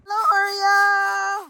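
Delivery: high, loud sing-song call; calling out to a pet. Real, not acted.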